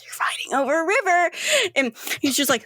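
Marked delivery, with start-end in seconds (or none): 0.0-1.3 "They're fighting over a river" said high and quick; higher, hurried echo, peaking on "river"; incredulous repeat. Acted. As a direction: push "river" up high in mock disbelief. Third, higher take of line.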